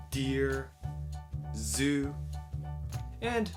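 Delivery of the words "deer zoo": usual speaking voice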